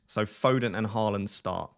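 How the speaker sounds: ordinary talk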